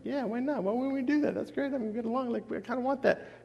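mock the innocent assumption; naive voice.

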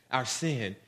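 ordinary talk

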